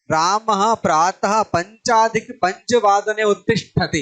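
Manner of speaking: slow, careful reading; slow example sentence. Shown as a demonstration. Teacher reading a Sanskrit example sentence slowly to students. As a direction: read the sentence slowly, word by word. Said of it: "समय ऐसे आएगा।".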